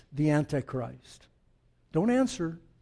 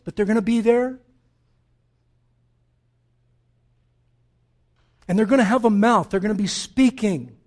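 emphatic, louder preaching with higher peaks; forceful preaching; 3 semitones higher, 6 dB louder than usual. Real, not acted. Preacher warning the church about the false prophet, forceful preaching. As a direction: preach it out, driving home each point.

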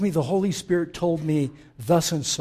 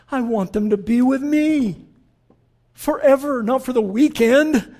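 preaching Jesus's prayer; 7 semitones higher, 7 dB louder than usual. Acted.

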